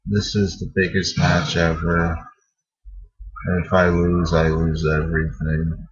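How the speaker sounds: mocking a dull promo read, low and quiet, zero excitement; bored John Cena promo. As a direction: drone it out like a lifeless promo that puts you to sleep. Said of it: "That's pretty much how it sounded. No excitement, no enthusiasm".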